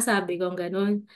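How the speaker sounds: ordinary talk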